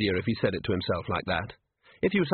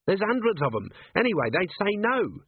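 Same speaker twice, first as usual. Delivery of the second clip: lightly voiced scruffy little watchman, higher, complaining; Nobby's voice; 10 semitones higher than usual. A narrator's reading. Nobby grumbling to the sergeant, a higher whiny voice. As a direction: whine it up a bit like a grumbling runt.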